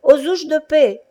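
high, loud call for help; shouting cobbler. Acted. Old cobbler calling from his shop, high loud shout. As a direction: yell it from the back of the shop. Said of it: "Un vieux cordonnier juif criait".

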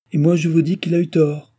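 a princess scolding a courtier; indignant rebuke. A narrator's reading. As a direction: put him firmly in his place. Princess rebukes Balsamo sharply.

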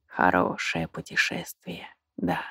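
barely audible, low and fading; last breath, far and faint; dying words. A narrator's reading. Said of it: "Последние слова прозвучали чуть слышно".

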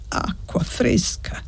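put-on soft, slow, whispery old man; muttering old man. A narrator's reading. Sick old man asking for water, muttering softly. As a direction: mutter it weakly and slowly. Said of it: "borbottò il vecchio".

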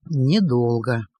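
low, choked with emotion; voice thick with emotion. A narrator's reading. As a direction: say it muffled by feeling. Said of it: "глухим от волнения голосом".

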